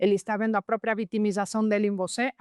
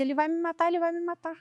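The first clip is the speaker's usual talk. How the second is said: imitated high-pitched panic; mock alarm; 9 semitones higher than usual. Student reacting to the coach's advice, mock-frightened. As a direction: retell her fright in a much higher voice.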